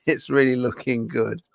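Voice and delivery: put-on Goonies kid voice. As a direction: read it like an excited Goonies kid, laughing through it. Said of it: "That sounds like the Goonies".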